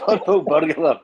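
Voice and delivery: put-on slow, loud "Purple burglar" laughing in a Scots accent; Scottish accent, laughing. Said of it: "the accent".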